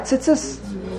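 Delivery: tzitzis as a quick, hissy "tsits-ss"; chirik like shva. Shown as a demonstration. Teacher checking the common mistake with students, short-vowelled tzitzis. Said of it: "That's really wrong".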